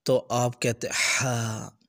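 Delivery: ordinary talk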